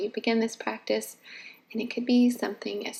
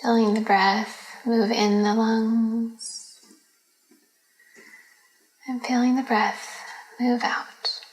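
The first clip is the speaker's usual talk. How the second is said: guided meditation; 6 dB louder than usual. Acted. Leads a yoga nidra meditation.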